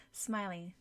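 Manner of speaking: plain reading voice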